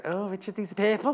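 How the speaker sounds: teasing Scouse imitation; mock Liverpool accent. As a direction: imitate a Liverpudlian saying purple. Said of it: "everyone in the studio goes".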